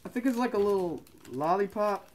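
muffled by cloth mask; through a face mask. Real, not acted. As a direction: mouth covered while speaking.

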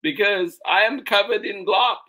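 high, loud, whiny complaint; indignant child. Acted. Charlie complains, second reading.

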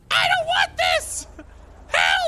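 panicked shout. Acted. Person on a ledge as others plead not to jump, panicked shout.

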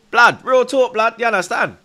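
loud, high hype shout; excited shout. Real, not acted. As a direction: yell it to rally the chat. Fan hyping the chat.

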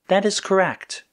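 high-pitched computer voice; computer statement. A narrator's reading. Computer confirming to Red that it is correct, high and matter-of-fact. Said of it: "Chroma Computer stated".

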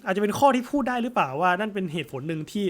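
usual speaking voice

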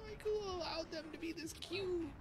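high, squealing over cuteness; crying-laughing. Retold or imitated. Fan watching Mario in the Goomba mask, high teary squeal. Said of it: "it's like".

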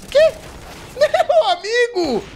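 high breathless laugh, then a slow, loud drawn-out cry; cracking up at an unbelievable play; laughing disbelief. Real, not acted. Streamer cracking up at a wild play, slow and laughing.

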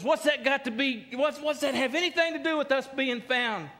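high-pitched, exasperated imitation; hammed-up frantic incredulity; aggravated disbelief. Frantic castaway questioning his companion's logic, high and aggravated. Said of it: "he gets so aggravated".

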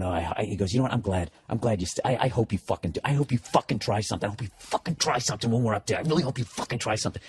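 low, soft menacing mutter; a hint of a sullen drunk threatening under his breath; threatening drunk guy. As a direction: play the drunk's quiet threat. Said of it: "he's sitting there going".

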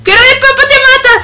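high frantic yell; panicked shout. Real, not acted. Player warning viewers while fleeing, high panicked yell.

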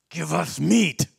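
loud, mock-angry crowd demand; insistent, hammed-up demand; demanding grumble. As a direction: belt it out like a complaining mob. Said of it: "and they grumbled".

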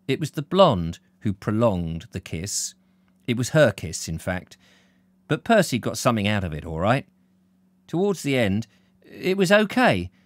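ordinary delivery, nothing marked

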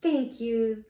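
plain reading voice

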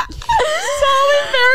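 very high, laughing wail; embarrassed squeal. Real, not acted. Confessing an awkward celebrity meeting to a co-host, real embarrassed squeal. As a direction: squeal it through helpless laughter.

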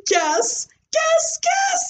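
high, insistent guessing game; excited kid begging the class; eager. Acted. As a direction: pester them to guess.

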